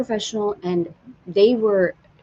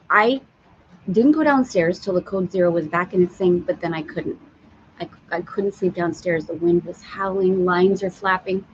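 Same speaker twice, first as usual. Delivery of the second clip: ordinary talk, nothing marked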